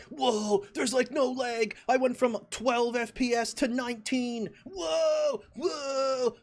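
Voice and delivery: high, loud, amazed shouting; reliving the thrill of zero lag, shouting high; excited gamer whoa. Retold or imitated. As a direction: gush like a kid seeing it for the first time. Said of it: "that was exciting".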